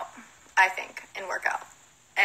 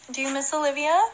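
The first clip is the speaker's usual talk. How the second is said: higher sing-song voice for the dog; pet talk; 7 semitones higher than usual. Real, not acted. Godmother asks the dog about Olivia in pet talk. Talking to her dog Docky.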